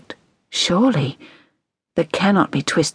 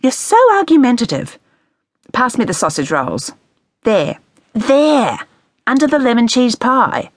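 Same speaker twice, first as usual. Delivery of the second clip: bossy mother; 7 semitones higher, 3 dB louder than usual. A narrator's reading. Mother bossing daughter.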